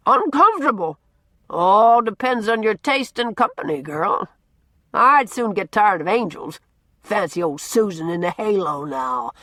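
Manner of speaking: put-on loud, gruff, teasing old man holding forth; blustering old Norman. A narrator's reading. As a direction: boom it out like a cranky, likeable bully. Norman scoffs.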